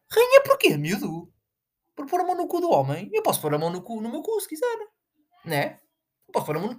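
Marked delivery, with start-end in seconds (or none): 0.1-1.3 high, loud scoffing outburst; indignant shout. Acted. Ranting at fans calling her a queen, a loud high indignant shout. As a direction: blast the word rainha high and loud.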